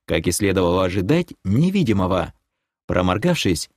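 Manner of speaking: plain reading voice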